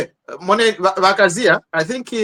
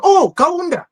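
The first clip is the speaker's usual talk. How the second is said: 1 excited recognition; 11 semitones higher than usual. Retold or imitated. Quoting foreigners' reaction.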